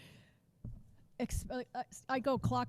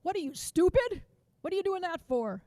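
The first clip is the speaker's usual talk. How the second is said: loud, high scornful jab; sarcastic loud put-down; mocking teacher; 8 semitones higher, 5 dB louder than usual. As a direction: bark it like a sarcastic old teacher. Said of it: "his exact words to me".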